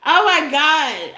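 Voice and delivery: slow, high and loud through laughter; cracking up at her own pettiness; laughing. Real, not acted. Reader laughing at trolls, slow giggly exclaim.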